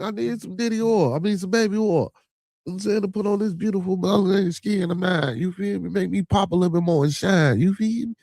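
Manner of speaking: high, playful mock pleading; he makes a big joke of needing baby oil; hammed-up excitement. Host to chat, begging for baby oil in a high excited joking voice. Hamming up wanting [name] oil.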